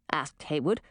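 ordinary delivery

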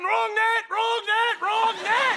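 loud, very high repeated shout; exasperated yelling. Acted. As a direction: yell it like a frantic coach at the sideline. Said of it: "I was yelling".

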